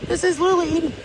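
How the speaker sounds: high, alarmed storm witness; frightened exclaiming. Real, not acted. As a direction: gasp it out, scared and awed. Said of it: "I'm scared".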